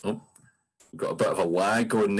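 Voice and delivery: ordinary delivery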